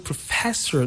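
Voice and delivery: "professor" stressed on FES; second-syllable stress. Shown as a demonstration. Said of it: "the stress is on the second syllable".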